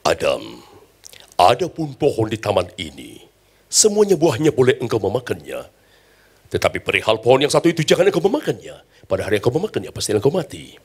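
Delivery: deep, booming voice of God speaking to Adam. Acted. The preacher plays God warning Adam, in a deep solemn voice. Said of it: "Tuhan berkata begini kepada Adam".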